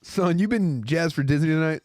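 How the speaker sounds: high, loud mock traffic cop. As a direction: grill the driver like a hammy cop. Mock cop questioning a driver.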